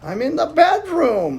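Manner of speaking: put-on high quavery old lady voice; wobbly voice. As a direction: fake a wobbly granny voice. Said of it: "in a strange, wobbly voice".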